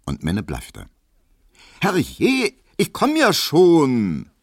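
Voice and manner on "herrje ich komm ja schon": high, loud, drawn-out holler; exasperated shriek. A narrator's reading. Maid calls to the impatient caller at the door, shrieking. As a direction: yell toward the door, stretching the words. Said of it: "schrie Hedwig drinnen".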